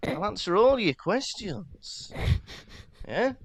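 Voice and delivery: high, slow mock old wizard calming the boy; mock Mad-Eye Moody. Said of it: "It's like".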